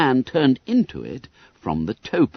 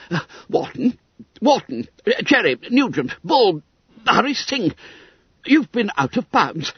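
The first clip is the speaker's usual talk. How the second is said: indignant fussy secretary; 10 semitones higher than usual. Acted. Secretary glares at the five juniors, high indignant scolding. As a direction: scold each boy by name, outraged. Twiss glaring, naming the boys.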